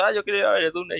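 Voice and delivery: high-pitched, whiny wife voice; high eager wife. Retold or imitated. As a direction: play the wife begging to go, way up high. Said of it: "y ella dice". (la mujer suplicando con voz aguda)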